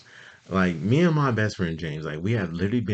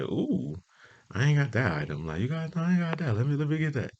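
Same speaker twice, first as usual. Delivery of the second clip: 1 excited shopper; 10 semitones higher than usual. Retold or imitated. Acts out spotting items in store.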